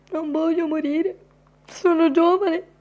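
high squeaky plea, hammed up; mock pleading. Mock plea while being chased.

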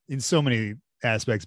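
normal talking voice

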